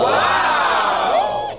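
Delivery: very high, loud wow; high wow shout. Real, not acted. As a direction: yell a shrill-high wow. Radio hosts cheering on air. (grito agudo)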